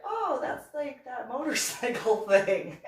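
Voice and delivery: high, loud and laughing; laughing realization. Retold or imitated. Vlogger laughing, retelling how she answered the bikers about Sturgis. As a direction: laugh as the joke dawns on you.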